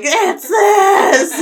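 high, loud yell through a laugh; mock audience scream. Imagined listeners yelling the answer at the hosts, mock screaming. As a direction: holler it like exasperated listeners, half laughing. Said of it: "People are probably screaming at us like".

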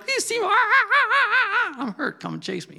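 very high laughing bird voice, then coming down; voicing the killdeer. Killdeer luring the listener away, high and laughing. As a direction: play the bird faking a hurt wing, giggling. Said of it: "They're like".

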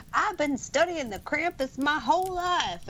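hint of a pompous old scholar; mocking old man quote. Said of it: "the old guy's like".

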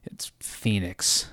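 slow breathy whisper; dramatic whisper. Retold or imitated. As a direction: hush it out like a secret name. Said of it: "he's like".

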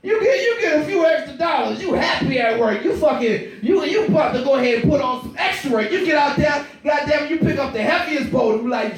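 usual speaking voice

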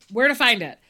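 ordinary talk, nothing marked

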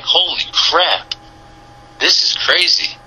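high, slow, stunned exclamation; amazed disbelief. Retold or imitated. Looking down at the lineup in the photo, a high stunned exclamation. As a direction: gasp it out like you can't believe your eyes.

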